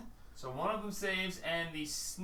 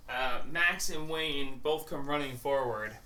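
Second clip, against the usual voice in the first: ordinary delivery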